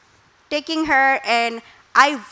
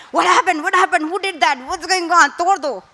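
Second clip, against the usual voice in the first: rushed, frantic questions, pitched up; panicked mother; 5 semitones higher than usual. Retold or imitated. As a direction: play a mother who panics at a crash.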